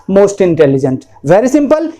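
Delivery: ordinary talk, nothing marked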